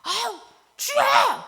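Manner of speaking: high, loud, drawn-out wail of "주여"; anguished cry. Shown as a demonstration. Showing how the wronged really pray, anguished cry. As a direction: cry it out from pent-up grief. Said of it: "뭐, 이렇게 돼요".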